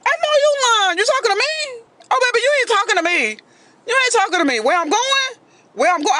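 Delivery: high, squealing disbelief; mock outrage. Comedian scoffs high and whiny at her date's question. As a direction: act shocked she'd even ask, high and whiny.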